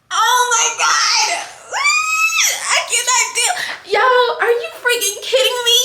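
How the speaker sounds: high, laughing and near crying; overwhelmed joy. Real, not acted. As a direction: shout it in happy disbelief. Overwhelmed by news.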